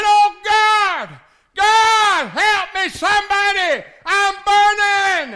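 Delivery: high loud desperate scream for help; screaming damned man. Acted. Lost man in the fire screaming to God for help. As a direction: cry out as a man burning. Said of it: "and he's screaming, and he's crying".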